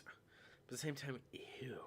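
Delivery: unvoiced whispered aside; whispering. Real, not acted. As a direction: whisper it to yourself. Quiet aside about card.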